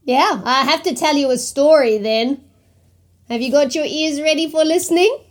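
plain reading voice

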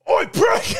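very high, loud holler; imitated rude shout. Imitating a man calling up to his housemate, rude shout. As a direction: yell it up the stairs. Character calls upstairs to housemate.

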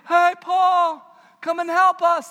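hammed-up Macedonian calling to Paul; mock Macedonian accent. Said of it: "That's how their accent sounds".

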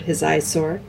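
plain reading voice

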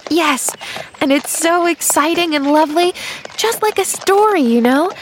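put-on high, bubbling child's excitement; happy little girl. A narrator's reading. Girl thrilled it's like a story, excited. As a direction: gush with delight as a little girl. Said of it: "gave a happy little bounce".

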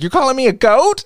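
high, loud, indignant question; mock affront, voice jumping high; outraged disbelief. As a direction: shoot up in offended disbelief. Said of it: "And she's like".

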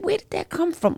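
high, bewildered question; baffled at a strange voice; puzzled. Retold or imitated. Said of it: "it was just bizarre to me".

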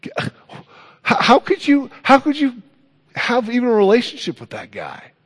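high, incredulous complaint; offended Pharisee. Retold or imitated. Pharisee objecting to Jesus befriending a tax collector, high and incredulous. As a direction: sputter in disbelief at Jesus.

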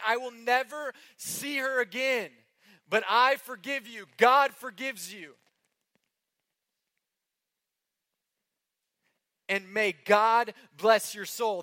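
slow, high, loud tearful plea; voicing a weeping mother. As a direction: give the mother's forgiveness through tears. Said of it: "In tears, the mother is weeping".

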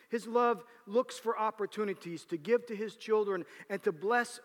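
high, loud preaching. Acted.

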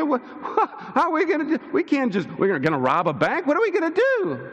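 high, fast panicky babble; mock flustered disciples fretting; panicked disciples. Preacher mimics panicking disciples asking what to do.

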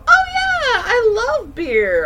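high gushing burst, slow on beer; gushing excitement. Retold or imitated. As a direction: gush like a happy fan. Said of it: "where you just go".

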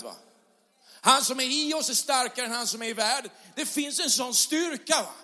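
rousing pulpit climax; full-force preaching. Acted. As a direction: preach it to the whole room with fire.

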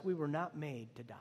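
breathed whisper, intimate; whispered conviction. Real, not acted. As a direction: drop to a whisper to land it. Intimate close to the point.

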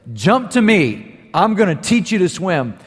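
lightly voiced parent urging a jump; parent calling to child. Parent to child at the pool's edge, calling encouragement.

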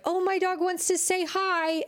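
high, sing-song mock owner voice; cheery dog owner. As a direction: chirp it like a clueless, cheerful owner. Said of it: "Someone was just like".

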